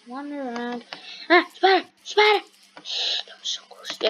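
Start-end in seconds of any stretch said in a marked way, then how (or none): 1.3-2.4 high startled shriek at a spider; screaming at a spider. Real, not acted. Spider appears suddenly. 2.8-4.0 whispering. Real, not acted. Relief after spider scare.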